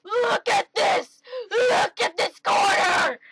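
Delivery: high, loud joyful screams; ecstatic shrieking. Real, not acted. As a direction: scream it out in delight. Shrieking over a quarter.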